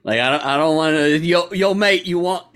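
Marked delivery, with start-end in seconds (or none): 1.1-2.5 higher-pitched mock Cockney geezer; hammed-up Cockney English; Cockney accent. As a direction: put on a cheeky Cockney dealer's accent. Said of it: "not a Cockney English accent".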